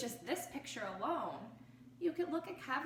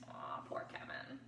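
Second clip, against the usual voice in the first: soft breathy whisper of sympathy; pitying whisper; 11 dB quieter than usual. Real, not acted. Teacher pitying Kevin, in a soft whisper. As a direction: feel sorry for him.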